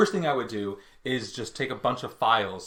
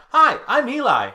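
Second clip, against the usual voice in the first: much higher, louder mock greeting; Eli; 12 semitones higher, 7 dB louder than usual. Shown as a demonstration. Castmates taking turns as Eli, high-pitched mock introduction. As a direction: pipe up in a silly high voice. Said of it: "we're doing it, Eli".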